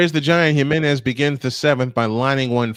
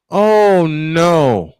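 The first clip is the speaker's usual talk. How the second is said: higher, louder real dismay; dismayed outcry; 4 semitones higher, 5 dB louder than usual. Real, not acted. Broadcaster watching player hold his knee, dismayed outcry. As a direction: let the worry out.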